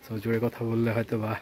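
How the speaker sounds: hushed, low-pitched vlogging voice; speaking softly. Real, not acted. Vlogger talking to camera among villagers, softly so nobody minds. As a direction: keep it down so the locals don't mind. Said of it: "আমি অনেক আস্তে কথা বলতেছি".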